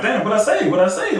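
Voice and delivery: high-pitched puzzled protest, imitated; mock protesting innocence. Player questioning the refs, mock protesting innocence. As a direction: act out a player querying the call. Voices Draymond querying the refs.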